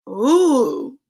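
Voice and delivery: usual speaking voice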